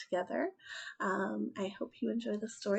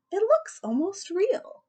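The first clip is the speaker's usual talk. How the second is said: higher, slow amazed shop lady; lightly voiced, higher amazed lady; marveling lady; 11 semitones higher, 5 dB louder than usual. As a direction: admire a lifelike doll. Store lady marvels at doll.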